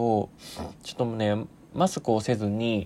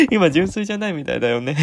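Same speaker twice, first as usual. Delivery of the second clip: laughing; 4 semitones higher, 7 dB louder, faster than usual. Real, not acted. Laughing at his own joke.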